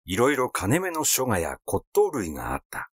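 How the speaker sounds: ordinary delivery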